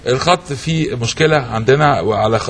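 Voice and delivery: low formal broadcast voice; announcer voice. Acted. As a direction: announce it like a TV host. Host announcer read.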